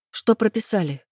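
mildly pressing; interrupting. A narrator's reading. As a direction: cut in anxiously. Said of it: "перебила я".

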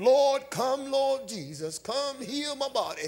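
high, drawn-out cry to the Lord; pleading prayer. Retold or imitated. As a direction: mimic a believer calling out to Jesus. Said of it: "You've been calling him".

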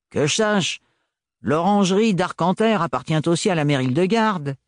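jeering mechanic, mildly grating. Acted. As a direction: needle him with a scraping tone. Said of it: "dit Gaël d'une voix grinçante".

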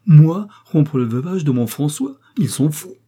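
loud, high protest; indignant cry. A narrator's reading. Widow rejecting rumor she'll remarry, crying out indignantly. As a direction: fling back the outrageous rumor. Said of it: "cria Geneviève".

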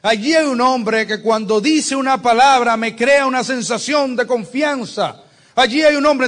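enthusiastic debtors. Acted. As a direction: preach it as joyous testimony. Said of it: "fue y dijo". (los endeudados alaban a David, con entusiasmo creciente)

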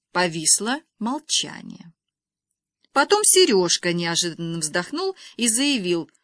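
ordinary delivery

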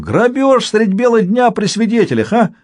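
high, gleeful amazement; exclaiming, mostly high-pitched, relishing the absurdity; delighted mock outrage. A narrator's reading. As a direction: marvel at it with theatrical delight. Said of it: "Самсон в восторге шлёпнул руками по бёдрам".

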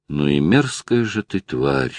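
usual speaking voice

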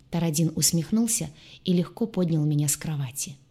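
ordinary delivery, nothing marked

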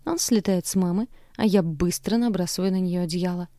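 ordinary delivery, nothing marked

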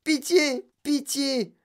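high-pitched pleading for help; panicked cry. A narrator's reading. Mother begging help for her daughter, panicked cry. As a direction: wail each plea high, in separate bursts. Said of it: "hurla madame Beauregard".

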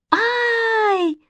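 long, very high shriek; drawn-out high squeal of fear; terrified wail. A narrator's reading. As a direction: wail out in fright. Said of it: "завопила Манечка".

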